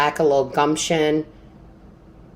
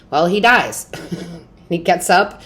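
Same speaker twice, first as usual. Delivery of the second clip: ordinary delivery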